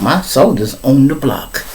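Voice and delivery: usual speaking voice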